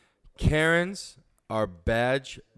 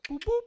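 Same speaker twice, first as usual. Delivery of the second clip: 1 radio beep imitation.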